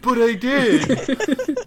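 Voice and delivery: high, drawn-out insistence; hammed-up wail of insistence; mock protest. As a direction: protest slowly like a wronged character. Acted protest of imagined movie character.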